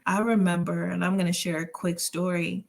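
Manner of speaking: usual speaking voice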